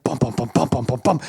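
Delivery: rhythmic bum-bum imitation; steady pulsing drum made with the voice; drum beat. Storyteller replays the documentary soundtrack, drum beat. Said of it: "this drum beat".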